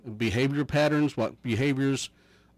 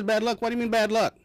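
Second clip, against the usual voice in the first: put-on high, puzzled child voice; puzzled boy; 8 semitones higher than usual. Little boy questioning his mother, high and puzzled. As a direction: play a confused little boy.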